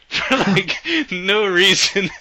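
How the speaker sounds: cracking up mid-sentence; laughing. Real, not acted. Laughing at the dub line.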